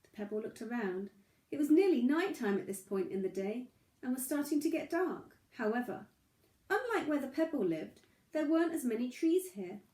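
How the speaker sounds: gentle, unhurried read-aloud; storybook narration. A narrator's reading. Reading a bedtime story to little Charlie, gentle narration. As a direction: tell it like a bedtime story for a small child.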